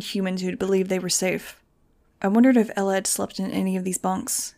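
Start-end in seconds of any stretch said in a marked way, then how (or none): none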